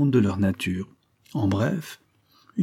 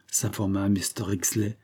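ordinary delivery, nothing marked

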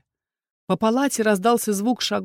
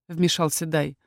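plain reading voice

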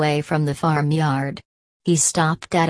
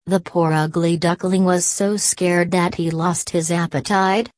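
plain reading voice

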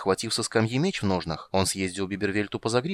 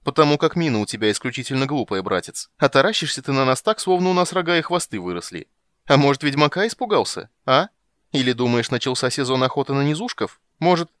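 mocking tease; 5 semitones higher, 6 dB louder than usual. Dandelion mocking the halfling.

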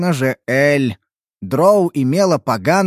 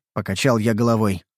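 plain reading voice

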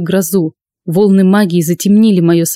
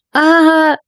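high scream; 9 semitones higher than usual. Acted. Maria cries out in fright.

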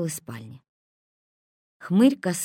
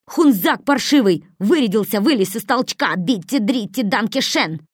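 furious insults; 4 semitones higher, 7 dB louder than usual. A narrator's reading. Хмырь grappling, cursing.

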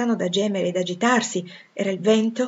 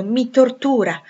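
higher, louder tormented outburst; anguished exclamation; 6 semitones higher, 8 dB louder, slower than usual. A narrator's reading. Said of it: "esclamò". (esclamato con tormento, a voce alta)

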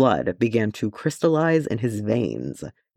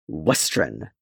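growled; 7 semitones lower than usual. A narrator's reading. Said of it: "Thorin growled".

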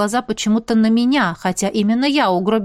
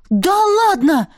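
high, loud, drawn-out exclamation; astonished, voice leaping up and stretched; stunned disbelief; 10 semitones higher, 5 dB louder, much slower than usual. A narrator's reading. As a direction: blurt it out, nearly choking at the news. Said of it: "Я чуть не подавилась от такого поворота".

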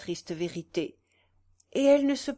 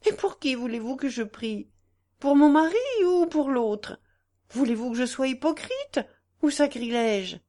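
indignant outburst; 5 semitones higher, 5 dB louder than usual. Acted. Daughter rebukes her mother.

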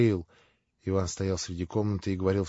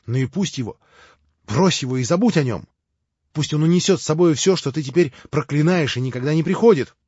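louder, higher, urging his brother to let go; passionate plea; 5 semitones higher, 6 dB louder than usual. A narrator's reading. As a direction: plead fervently, rising. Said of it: "неудержимо вскричал Алёша". (горячо, неудержимо)

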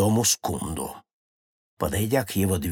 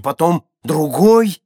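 usual speaking voice

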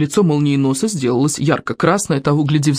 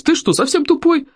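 high-pitched exasperated jab; snapped; 11 semitones higher than usual. A narrator's reading. Molnienos rebukes Hiccup for a silly question, exasperated. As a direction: throw it out indignantly, pitch jumping up. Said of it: "рявкнул Молниенос".

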